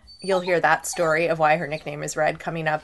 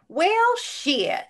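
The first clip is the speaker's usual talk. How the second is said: high, drawn-out "Well, shit"; Southern drawl quote; 11 semitones higher than usual. Retold or imitated. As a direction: toss it off like a Southern comedian. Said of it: "my new favorite saying is".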